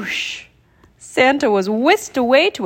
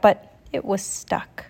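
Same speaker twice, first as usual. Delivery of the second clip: ordinary delivery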